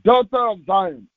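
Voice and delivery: ordinary talk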